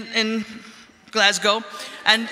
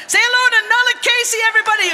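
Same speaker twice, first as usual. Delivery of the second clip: raised, higher MC call to the audience; rally the crowd with a big higher shout; 9 semitones higher, 4 dB louder, faster than usual. Real, not acted. MC calling on crowd to cheer.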